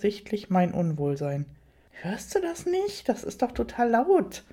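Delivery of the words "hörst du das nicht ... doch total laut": alarmed protest. A narrator's reading. Narrator to Rainer about the noise, high-pitched worried protest. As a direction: protest anxiously in a higher voice.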